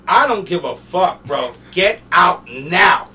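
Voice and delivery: loud, drawn-out order to leave; stern security guard. Retold or imitated. Security guard throwing him out, stern. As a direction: lay it down hard as the guard.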